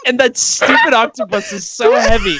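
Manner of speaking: put-on whiny, strained old man voice; a groaning old man half laughing. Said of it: "you're an arthritic old man".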